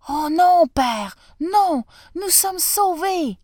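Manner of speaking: high, loud joyful outburst; overjoyed cry. Acted. Son tells his father they are saved, high joyful cry. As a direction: burst out with relief and joy.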